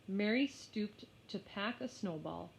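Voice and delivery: plain reading voice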